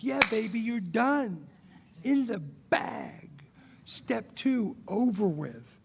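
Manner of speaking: drawn-out, celebratory "it's in the bag" delivery; emphatic assurance. Acted. Reassuring a newcomer that step two is done, emphatic. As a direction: stretch the key words with showy certainty. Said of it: "Emphatically, like".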